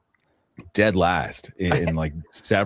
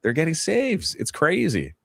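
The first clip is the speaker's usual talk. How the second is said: much higher, playfully incredulous voice; high-pitched repeat; 11 semitones higher, slower than usual. Pretend. Analyst repeating his point to a mock-shocked host, high-pitched.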